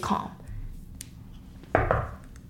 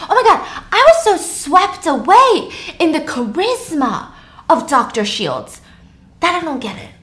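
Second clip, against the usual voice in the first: Jessica's inner voice; 4 semitones higher than usual. Acted. Voicing Jessica's realization.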